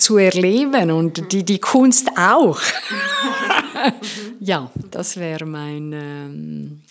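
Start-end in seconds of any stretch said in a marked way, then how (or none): 2.7-4.3 bursts into a high laugh. Real, not acted. Voice coach laughing at her own line, high laugh. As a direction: laugh it out, delighted.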